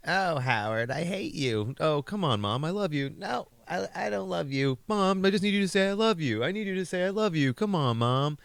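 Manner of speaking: high, mocking mother-and-son squabble voice; mimicking Howard doing his mom. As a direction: ham up a whiny high-pitched mother and son. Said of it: "he was doing a voice of his mother being like".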